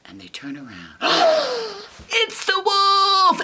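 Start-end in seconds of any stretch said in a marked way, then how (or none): 1.0-1.8 sharp high gasp; high startled gasp. Acted. Pigs spotting the wolf, startled gasp. 2.1-3.4 high, slow, loud alarm; frightened cry. Acted. Pigs seeing the wolf, high frightened cry.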